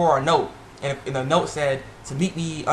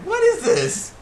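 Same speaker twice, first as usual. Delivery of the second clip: high-pitched incredulous squeal; mock disbelief; slower than usual. Fan seeing the bronze cabbage statue, mock disbelief. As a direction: jump up high in amused bewilderment.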